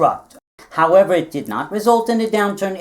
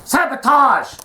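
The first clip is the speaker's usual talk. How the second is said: rising, slowing parrot yell; parrot shouting; 7 semitones higher, slower than usual. Acted. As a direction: screech the accusation like a parrot. Parrot puppet accuses loudly.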